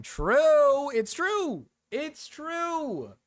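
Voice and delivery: high, drawn-out "true"; exaggerated agreement. Streamer backs a joke claim, exaggerated agreement. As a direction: stretch it out high in mock conviction. Mock-emphatic agreement with a meme.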